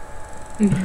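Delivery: a soft unvoiced giggle; breathless giggle. Real, not acted. Reader giggles quietly at her co-reader's teasing.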